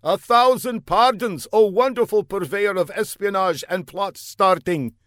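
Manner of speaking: earnest, high put-on foreign voice; an eager young spy calling out; high comic foreigner. A narrator's reading. Fla calls down the block to the older man, high and eager.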